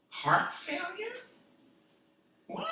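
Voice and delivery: shocked double take at the sign; shocked disbelief. Retold or imitated. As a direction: pitch jumps up on failure, stunned.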